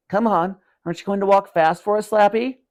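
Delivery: high teasing sing-song; boy teasing the dummy to walk; mocking taunt. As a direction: goad the dummy playfully, up high.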